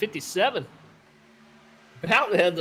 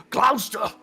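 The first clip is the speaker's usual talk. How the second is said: loud, higher mock New England "GLOUSE-tah"; put-on regional accent; 7 semitones higher, 5 dB louder than usual. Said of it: "try to say it all".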